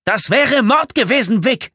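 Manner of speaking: high, loud, outraged shout; screaming. A narrator's reading. Tommy accusing Vic of murder, screaming. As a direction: yell the accusation at him. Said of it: "schrie Tommy".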